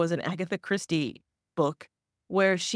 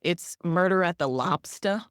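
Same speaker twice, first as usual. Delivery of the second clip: usual speaking voice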